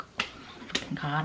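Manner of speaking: breathless whisper of dread; hushed terrified whisper; scared whisper. Retold or imitated. Scared, man approaching.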